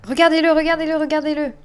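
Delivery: high, loud disbelieving call; calling the viewers to witness; indignant look at him. Real, not acted. Streamer calling viewers to look at the thief, high and indignant.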